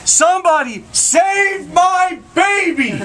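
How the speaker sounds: high, slow, drawn-out shout for help; desperate mother's cry. Acted. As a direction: scream it up from the rooftop, stretching every word.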